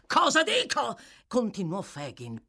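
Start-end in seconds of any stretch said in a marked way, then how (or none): 0.1-1.0 loud, much higher furious echo; seething outburst; mad with rage. A narrator's reading. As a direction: throw her words back, raging. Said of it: "pazzo di rabbia".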